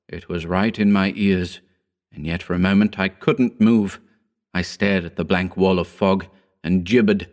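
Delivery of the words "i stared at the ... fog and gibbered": plain reading voice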